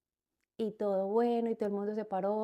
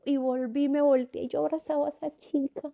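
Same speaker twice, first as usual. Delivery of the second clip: excited; 5 semitones higher, 6 dB louder than usual. Real, not acted. Revive el abrazo emocionada.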